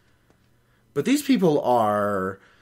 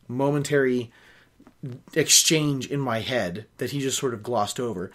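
ordinary delivery, nothing marked

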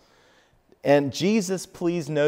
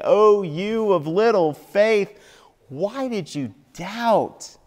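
higher, pleading voice of Jesus; fervent, higher loving rebuke; Jesus rebuking lovingly; 8 semitones higher, 4 dB louder than usual. Acted. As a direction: speak it as Jesus reaching for you. Jesus speaks to us now.

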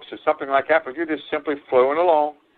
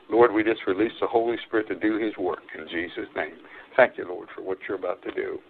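soft, earnest spoken prayer; 4 semitones lower, 4 dB quieter than usual. Acted. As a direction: pray over the people humbly. Preacher prays before reading.

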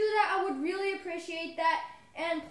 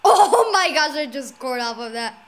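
excited; 14 dB louder than usual. Real, not acted. Just scored a goal.

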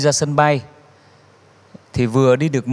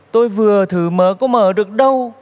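excited blurt; 7 semitones higher, 3 dB louder than usual. Retold or imitated. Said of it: "nói bồi thêm một câu".

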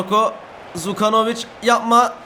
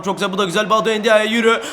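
ordinary delivery

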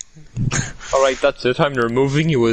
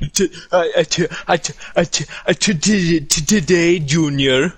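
higher-pitched stammered heckle, tripping on the first sound; mock stammer; 6 semitones higher than usual. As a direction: stutter it out in a higher, taunting voice. Taunting someone slow to join.

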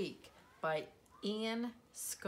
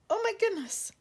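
high, louder gasp of surprise; astonished exclamation; 14 semitones higher, 5 dB louder, much faster than usual. Pretend. Reader revealing the surprise to the child, astonished exclamation.

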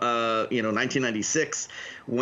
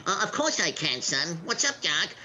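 higher cartoon rabbit voice; Bugs Bunny voice; 6 semitones higher than usual. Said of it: "can you be Bugs Bunny".

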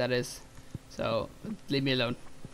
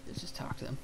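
ordinary talk, nothing marked

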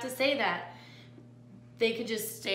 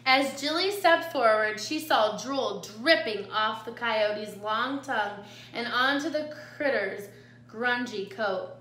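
usual speaking voice

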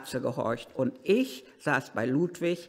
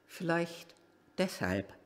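musing echo, much softer; 8 dB quieter than usual. Real, not acted. Driver musing to himself, softer echo. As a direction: repeat "vielleicht" far softer, fading away.

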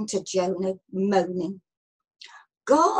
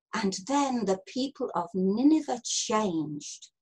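plain reading voice

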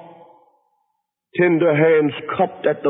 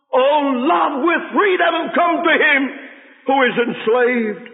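crying out in prayer; 10 semitones higher, slower than usual. Acted. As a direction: call on love from his knees. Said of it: "and he cried".

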